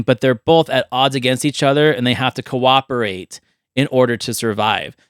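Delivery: ordinary talk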